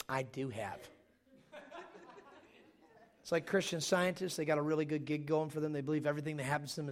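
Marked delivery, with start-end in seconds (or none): 0.1-0.8 mock positive voice. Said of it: "they get all positive".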